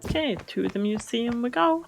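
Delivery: ordinary delivery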